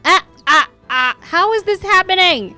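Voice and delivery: high, startled cry of disbelief; shocked. Real, not acted. Gamer shocked by her sim, high startled voice. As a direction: caught off guard, voice jumping up.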